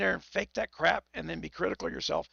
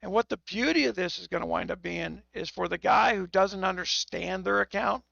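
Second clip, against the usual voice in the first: ordinary delivery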